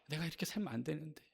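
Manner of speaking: low, subdued self-reproach, acted out; guilt-ridden. Believer doubting their own faith, guilt-ridden. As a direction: murmur to yourself as if weighed down by guilt. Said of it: "늘 죄책감에 시달립니다".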